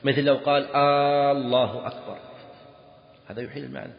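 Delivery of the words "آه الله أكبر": long drawn "Aaa" opening الله; الله begun with a loud prolonged aa; stretched-vowel demo. Shown as a demonstration. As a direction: hold the first a of الله like a question particle. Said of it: "يقلب الجملة الخبرية إلى استفهامية".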